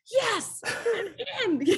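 high, excited squeal of a yes; thrilled yes. Retold or imitated. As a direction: jump at the chance like a kid offered candy. Jumping at a double murder trial.

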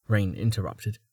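usual speaking voice

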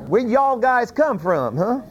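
lightly hammed-up Southern twang; Southern accent. Joking as the Levite with the spies, mock Southern accent. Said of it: "he had a southern accent".

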